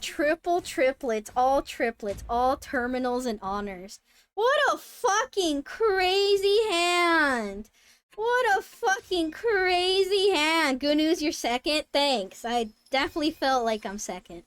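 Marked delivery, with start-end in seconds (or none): 4.3-7.7 high, loud and very slow; awed disbelief. Real, not acted. Streamer stunned by a rival's yakuman, slow and loud. 8.2-10.8 high, loud and slow, a touch quicker; awed disbelief again. Real, not acted. Streamer repeating awe at the crazy hand, slow and loud.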